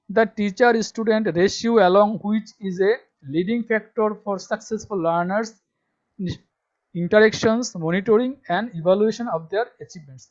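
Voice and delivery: reads the English line out very slowly and loudly, word by word; slow dictation reading. Shown as a demonstration. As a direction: spell it out slowly for students to copy. Reading syllabus text aloud. (ধীরে ধীরে জোরে পড়ে শোনানো)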